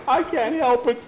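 high scared whine; frightened whine. Acted. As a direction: whine it with knocking knees. Knees shaking with fear.

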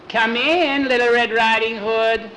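high, quavery-sweet stage voice; hammed-up wolf posing as granny; fake grandma. Horace in bed answering the knock, high fake-grandma voice.

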